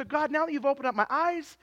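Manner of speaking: high, earnest pleading prayer; acted confession to God. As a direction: plead with the Lord as a straying child. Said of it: "We come back to the Lord and say".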